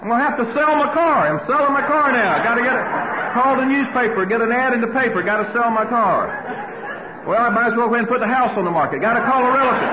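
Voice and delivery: high, loud, racing mock panic; comic frantic catastrophizing. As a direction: rattle it off in rising alarm. The panic spiral at its peak.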